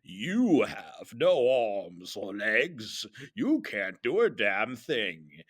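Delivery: slow, drawn-out evil emperor; theatrical villain, words stretched out; Darth Sidious. Acted. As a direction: gloat like a sinister overlord. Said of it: "Darth Sidious then laughed in his famous laugh".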